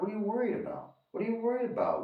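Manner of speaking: high-pitched mock of an unworried friend; carefree dismissal. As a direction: imitate a friend breezily waving it off, way up high. Friend again dismissing the speaker's worry.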